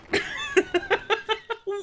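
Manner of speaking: shrill burst of disbelieving laughter; high shrieking laugh. Real, not acted. Laughing at the community for keeping the secret, a high shriek.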